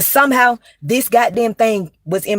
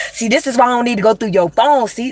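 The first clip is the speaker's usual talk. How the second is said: ordinary talk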